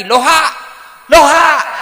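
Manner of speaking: high, booming mock caller; comic phone holler. Imitating Haji calling with reversed greeting, comic hollering. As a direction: bellow the backwards greeting in earnest.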